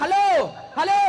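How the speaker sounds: loud, rising shout into the phone; shouting halo. Retold or imitated. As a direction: yell it into the wrong end. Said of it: "Dia teriak".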